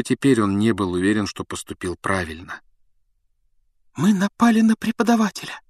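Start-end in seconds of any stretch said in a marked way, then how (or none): 3.9-5.6 high, stunned cry of horror; horrified. A narrator's reading. Hermione stares at Snape, horrified. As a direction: realise what you've done. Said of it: "В ужасе прошептала Гермиона".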